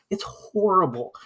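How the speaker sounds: ordinary talk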